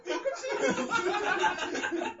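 high, loud laughing yell of a band name; laughing shout. Real, not acted. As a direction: blurt it out in a fit of laughter. Shouting random eighties band.

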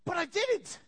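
high-pitched blurted confession; mock admission. Person admitting to God they failed, high mock blurt. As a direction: burst out with the failure after the excuses.

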